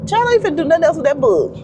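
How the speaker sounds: high, sassy retort. Acted. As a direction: call them out with attitude. Sassy comeback to readers.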